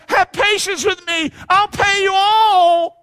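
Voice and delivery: pleading, slow, high shout; begging servant. Acted. Servant at his master's feet begs, shouting slowly. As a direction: beg for mercy, drawn out loud.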